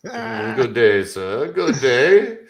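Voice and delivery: mock old English "good day, sir"; old English accent. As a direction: dismiss him like a stuffy old English lord. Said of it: "continue with that accent".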